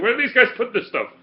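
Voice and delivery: high-pitched baffled bartender; Captain America bartender. Retold or imitated. Bartender marvels at how much they drink, high and baffled. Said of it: "the bartender in Captain America".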